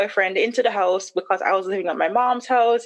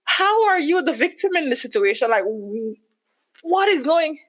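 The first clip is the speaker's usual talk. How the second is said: incredulous; 8 semitones higher than usual. Retold or imitated. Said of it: "I'm like".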